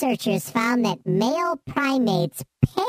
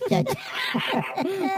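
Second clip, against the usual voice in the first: high laugh; 7 semitones higher, 3 dB quieter than usual. Real, not acted. Laughing after taking a hit.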